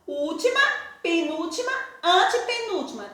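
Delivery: usual speaking voice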